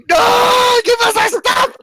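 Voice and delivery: high-pitched, hammed-up scream; screaming back. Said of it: "I'm gonna scream back".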